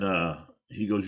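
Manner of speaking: ordinary talk, nothing marked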